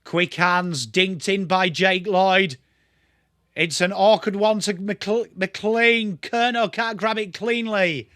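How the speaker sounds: a hint of a TV footy caller; light mock commentary. Streamer calls the play for viewers, lightly voiced commentary. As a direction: narrate the passage of play like a broadcaster.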